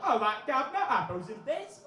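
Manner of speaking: hammed-up English geezer accent; mock English accent. Audience member mocking the singer's accent, mock English accent. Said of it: "slag me to my face and do my accent".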